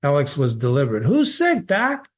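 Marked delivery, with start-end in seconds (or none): 1.0-2.1 Alex's voice. Acted. Alex calling to the doctor.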